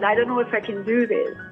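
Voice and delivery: ordinary talk, nothing marked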